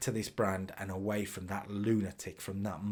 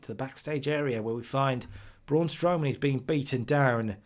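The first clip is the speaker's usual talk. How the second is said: usual speaking voice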